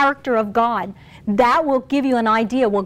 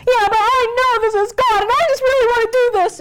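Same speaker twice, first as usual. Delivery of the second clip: high, pleading whine; whining; 11 semitones higher, 3 dB louder than usual. Acted. Wife begging husband to give in, whining. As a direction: whine like you won't take no. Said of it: "And you whine and you whine".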